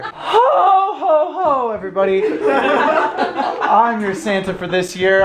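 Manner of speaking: high jolly Santa laugh. Acted. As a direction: boom out a jolly greeting. Santa greeting the family.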